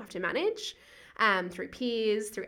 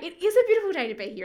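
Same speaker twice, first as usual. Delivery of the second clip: bright, higher and louder, quick and sing-song; mock-cheery reply, a bit higher and faster; cheerful insistence; 8 semitones higher, 5 dB louder, faster than usual. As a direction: chirp it back at him happily. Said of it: "And I say".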